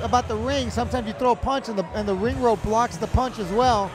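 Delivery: usual speaking voice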